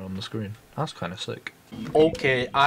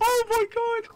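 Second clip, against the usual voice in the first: excited squeal. Real, not acted. Excited squeals during chase.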